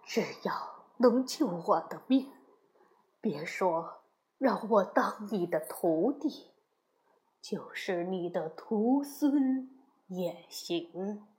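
low, soft, anxious begging; weak, frightened master giving in; desperate monk. A narrator's reading. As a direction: plead meekly for your life, slowing at the end. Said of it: "唐僧迫不及待地说".

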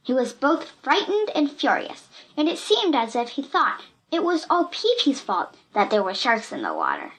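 ordinary delivery

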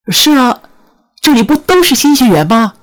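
high-pitched, loud, puzzled reply; baffled. A narrator's reading. New student baffled by a girl's odd question, puzzled and high. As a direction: answer in loud, high bewilderment. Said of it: "我莫名其妙地说".

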